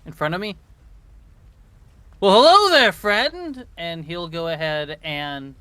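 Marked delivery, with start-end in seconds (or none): 2.2-3.6 loud, high swooping hello; hailing greeting. Acted. Player hailing a creature as a friend, loud swooping greeting. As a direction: sing out a big greeting.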